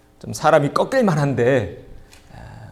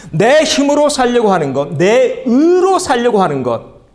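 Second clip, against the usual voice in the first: higher and louder, pressing each phrase; preaching emphasis; 9 semitones higher, 6 dB louder than usual. Acted. Pastor pressing the congregation, loud and high. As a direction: drive the point home from the pulpit.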